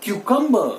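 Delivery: "cucumber" as KYOO-kum-buh, high and falling; correct pronunciation. Shown as a demonstration. Teacher revealing the right cucumber, said KYOO-kum-buh. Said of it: "फिर से".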